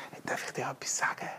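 ganz stimmlos und schnell gehaucht; flüstern. Retold or imitated. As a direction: ein Geheimnis ins Ohr hauchen. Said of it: "dann sage ich". (Vater flüstert seinen Kindern ins Ohr)

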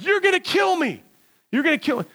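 very high, squealed retold plea; mock panicked protest. Stuck kid pleading as he sinks further, mock panic. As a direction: act out frantic alarm in a high voice.

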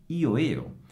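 ordinary talk

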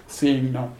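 normal talking voice

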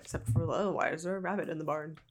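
ordinary talk, nothing marked

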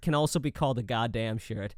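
ordinary talk, nothing marked

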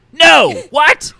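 high, loud bursts; exasperated shouting. Acted. Confused characters bickering over who lost, exasperated shout. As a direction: shout it, baffled and fed up.

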